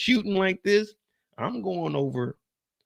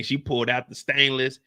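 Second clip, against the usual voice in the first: usual speaking voice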